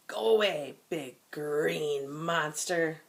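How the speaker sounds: slow, loud chant with a falling "Go away". Shown as a demonstration. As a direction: chant it firmly to send the monster off. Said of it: "That was a good loud voice".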